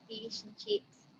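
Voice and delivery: "fish and chips" with tight Australian vowels, nearly "feesh and cheeps"; Australian accent on "fish and chips", vowels squeezed; Aussie accent. Shown as a demonstration. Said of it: "and an Aussie accent".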